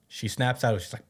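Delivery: ordinary talk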